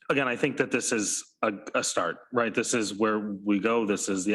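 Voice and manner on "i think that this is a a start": normal talking voice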